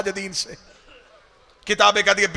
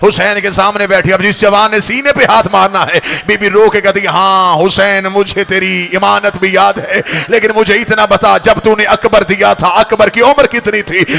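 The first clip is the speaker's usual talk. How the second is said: loud wailing majlis cry, louder; preaching at full force; 8 dB louder than usual. Acted. Preacher crying out Zainab's plea to the mourners, loud wail. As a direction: drive the mourners to beat their chests.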